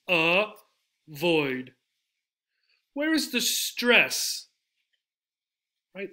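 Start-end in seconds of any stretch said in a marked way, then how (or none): 0.1-1.7 avoid in two separate beats, "uh ... void"; avoid, syllable by syllable; split into syllables. Shown as a demonstration. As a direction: break avoid apart with a pause. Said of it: "Two syllables".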